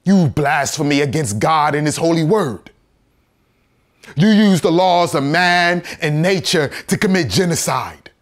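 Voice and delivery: impassioned, forceful condemnation; loud accusation. Acted. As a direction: hurl the charge at the court. Accusing the court.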